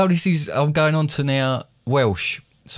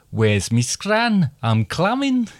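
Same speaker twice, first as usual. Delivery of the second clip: Geordie accent; 6 semitones higher, slower than usual. Shown as a demonstration. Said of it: "come down the country a little".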